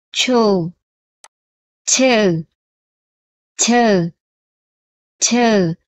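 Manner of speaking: each syllable like "cha", "cho", "chu" said several times slowly; teacher drilling syllables three times each; repeat drill. Shown as a demonstration. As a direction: model for the class to repeat. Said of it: "Đọc là".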